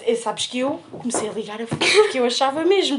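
ordinary talk, nothing marked

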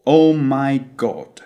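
slow British accent, God with a rounded O; British closed O. Shown as a demonstration. Teacher answering the American version, British closed O. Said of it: "they do pronounce an O".

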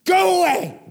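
a wild, piercing demonic shout; demon scream. Acted. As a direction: shriek it like a demon driven out. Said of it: "demons to start to scream".

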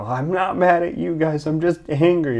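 high, loud mock shouting; imitated yelling. Imitating his boss still yelling while apologizing to the crew, mock yell. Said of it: "You're still yelling".

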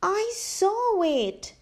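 put-on high, slow small child insisting; little bear voice. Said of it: "said Baby Bear".

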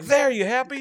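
loud mock-defiant retort; defiant shout. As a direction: drunkenly challenge her. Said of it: "I was like".

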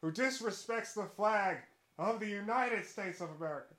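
booming pompous pledge, then dropping off; hammed-up flag-waving pledge; mock patriotic declaration. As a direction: declare it like a grandstanding PR statement. Mock patriotic statement, repeated.

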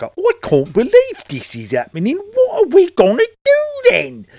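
mocking, hammed-up bad English accent; very high, loud, melodramatic fake English accent, dragging the last question out; Rachel. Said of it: "Rachel in particular was like".

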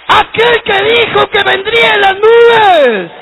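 high, loud, slow fervent cry; shouting preacher. Acted. Pastor crying out about Christ's return, fervent shout. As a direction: cry it out to the congregation.

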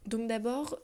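normal talking voice

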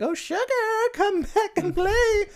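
high falsetto voice. Retold or imitated. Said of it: "Daphne's like".

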